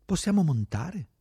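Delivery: high-pitched question; eager call. A narrator's reading. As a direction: call up asking for a lift. Companion calls up to ask.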